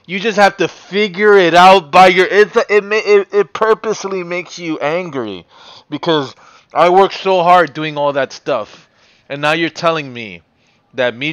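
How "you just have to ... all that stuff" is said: frustrated outburst. Real, not acted. Frustrated by the game.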